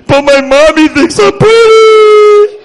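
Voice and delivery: high, loud, drawn-out protest; mock-hurt whine. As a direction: wail it like a slighted little kid, hammed up. Mock hurt child bragging to audience.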